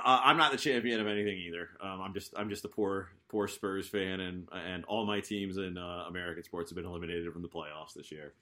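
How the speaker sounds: ordinary delivery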